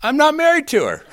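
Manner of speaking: high, fast joking quip; Mister Play's high, fast wisecrack to his golf buddies; deadpan punchline. Retold or imitated. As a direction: toss off the punchline high and quick. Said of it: "He goes".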